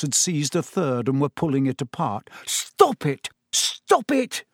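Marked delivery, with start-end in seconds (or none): 2.4-3.3 higher, sharp cry to the books. A narrator's reading. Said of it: "cried the manager". 3.5-4.4 even higher repeated cry. A narrator's reading. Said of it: "cried the manager".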